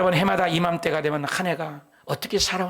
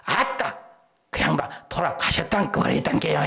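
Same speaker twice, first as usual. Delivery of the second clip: Jeolla dialect. Retold or imitated. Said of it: "전라도 사람도".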